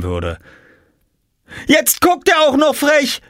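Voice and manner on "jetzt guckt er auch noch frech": put-on very high, louder girl's yell; high shrill tattling sister; screaming. A narrator's reading. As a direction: yell an accusation. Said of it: "schrie Jule".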